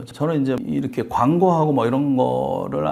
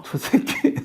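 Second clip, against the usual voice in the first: mock whisper; 7 semitones higher, much slower than usual. Onlookers glaring at detective.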